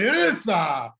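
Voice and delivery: high, loud imitated yell; retold yell. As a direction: mimic the teacher's drawn-out cry.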